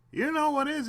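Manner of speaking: mocking the guy who says it's fine, high and loud; mock cheerful voice. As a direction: mimic someone brushing it off, high and bright. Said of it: "he says".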